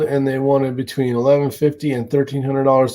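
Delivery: ordinary talk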